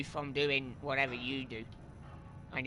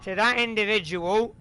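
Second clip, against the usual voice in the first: loud, higher angry call-out; 3 semitones higher, 7 dB louder than usual. Real, not acted. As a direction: shout the ban at him. Banning a commenter loudly.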